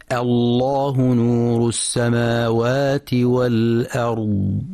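slow drawn-out recitation, thick L in "الله"; heavy lam, light hamza. Shown as a demonstration. Teacher reciting an opening example, heavy lam after light hamza. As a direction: start "الله" on a light hamza, then darken the L. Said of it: "فإن اللام تفخم مع مراعاة ترقيق الهمزة".

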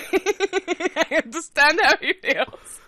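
slow, high, laughing repeat; drawn-out gleeful voice cracking with laughter; laughing relish. Real, not acted. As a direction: break into giggles while savouring it. Repeats it, relishing his failure.

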